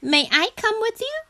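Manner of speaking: high, quick excited frog voice; high-pitched, excited little frog; great excitement. A narrator's reading. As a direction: ask eagerly, high and fast. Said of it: "asked Frog in great excitement".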